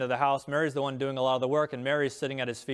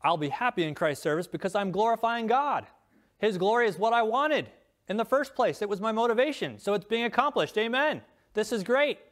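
joyful preaching; 6 semitones higher than usual. Acted. Preacher rejoicing over God's glory.

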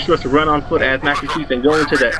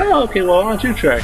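higher-pitched, put-on agreeable reply; lightly sing-song retold handover; mock agreeable; 6 semitones higher than usual. Big brother handing over the driving, mock agreeable.

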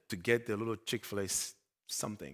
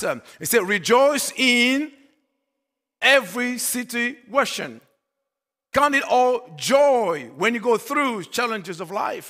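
preaching; 9 semitones higher, 10 dB louder than usual. Acted. Pastor urging joy through life's challenges, preaching. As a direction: stretch the key words and proclaim them.

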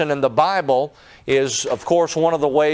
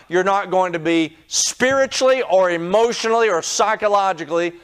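raised, higher, urgent preaching voice; fervent preaching; 4 semitones higher than usual. Acted. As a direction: preach it out to the congregation, climbing in pitch. Preacher rises into full preaching.

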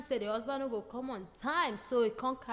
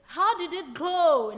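eager question; 5 semitones higher, 9 dB louder than usual. Acted. Grandma asks eagerly.

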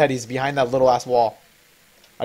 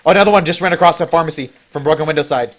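excited callout; 4 semitones higher, 10 dB louder than usual. Real, not acted.

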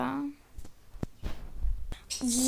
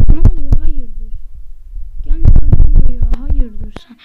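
loud outraged yelling; indignant shout; 18 dB louder than usual. Real, not acted. An enemy shows up and kills, indignant shout. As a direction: bark at the attacker, offended.

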